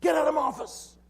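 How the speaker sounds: high, loud furious shout; firing shout. Retold or imitated. Boss throwing out the man he just fired, shouting. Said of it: "he carries on, and he fired me".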